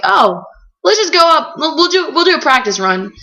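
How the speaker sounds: high, upbeat carefree voice; breezy friends. Retold or imitated. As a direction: sound cheerfully careless. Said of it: "they were like".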